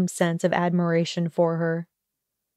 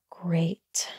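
sarcastic whisper under the breath; whispered sarcasm; 2 semitones lower, 7 dB quieter than usual. Acted. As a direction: breathe it out, unimpressed. Hermione's sarcastic aside after request.

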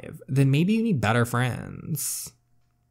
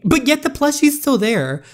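much higher, louder, delighted reaction; squealing over the cute plushie; excited squeal; 13 semitones higher, 7 dB louder than usual. Real, not acted. Player delighted the plushie survived, excited squeal.

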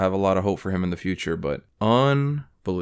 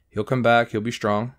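ordinary delivery

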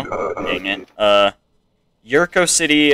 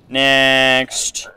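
drawn-out call of "Next"; 3 semitones higher than usual. Pretend. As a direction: call the next entrant, stretching it. Calling up next border entrant.

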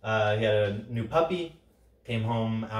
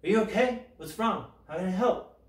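puppy's gentle voice; 8 semitones higher than usual. Retold or imitated. Puppy to its upset owner, gently concerned. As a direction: ask it like a caring puppy, gently. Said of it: "as if to say".

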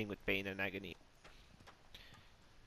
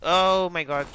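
loud, higher burst of alarm; startled shout; 6 semitones higher, 12 dB louder, slower than usual. Real, not acted. Player shocked at spotting an enemy, startled shout. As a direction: exclaim at a sudden shock.

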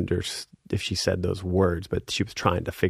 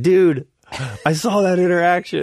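high, loud bandmate's exclamation; imitated gleeful outburst, pitched up; excited teasing; 11 semitones higher, 5 dB louder than usual. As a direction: call it out, delighted to have caught him. Bandmate gleefully confronts him.

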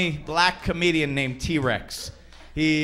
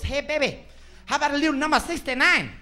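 higher put-on voice of a husband, quick on the proposition; a sleazy husband sweet-talking his wife, pitched up; husband in the joke; 9 semitones higher, faster than usual.

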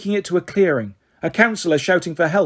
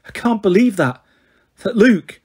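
faltering voice; 5 semitones higher, 3 dB louder than usual. A narrator's reading. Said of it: "Annabeth's voice faltered".

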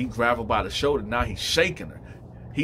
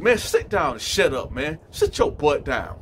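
raised, forceful retold command; higher, heated order; gruffly ordering; 4 semitones higher, 4 dB louder than usual. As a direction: act out shutting someone down. Inmate orders other to sit.

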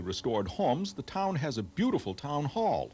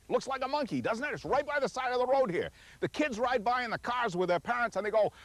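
high-pitched, excited pointing-out of a sight; much higher than usual, tickled by the landmark; 10 semitones higher than usual. Real, not acted. Tour host showing off a rock that looks like a monkey, excited. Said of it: "So do I".

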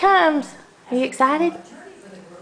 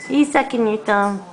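normal talking voice

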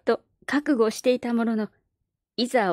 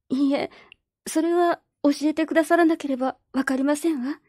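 put-on a higher, earnest girlish voice; politely but firmly refuse; young girl Perrine; 5 semitones higher than usual. Orphan girl telling the neighbours she must leave, earnest girlish voice.

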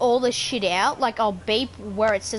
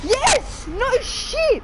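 excited shout; 12 semitones higher, much slower than usual. Real, not acted. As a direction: blurt it out, thrilled. Excited reply to being asked if scared.

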